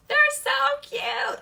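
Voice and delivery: high, loud, drawn-out squeal of delight; gushing. Real, not acted. Showing viewers the new cute pouches, gushing. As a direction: gush over how adorable it is, high and slow.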